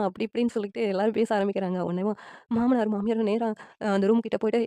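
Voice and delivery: usual speaking voice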